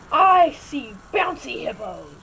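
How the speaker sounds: loud, drawn-out mock cry with a gasp; acted gamer shouting. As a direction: holler it hammed-up, like delirious late-night play. Reenacts late-night gaming yelling.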